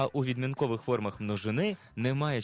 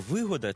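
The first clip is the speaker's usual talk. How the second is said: вигода with the stress on ГО: виГОда; stress on second syllable; 5 semitones higher than usual. Shown as a demonstration. Asking viewers which stress is right, stressed виГОда. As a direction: lean on the middle syllable, виГОда. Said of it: "із наголосом на другому складі".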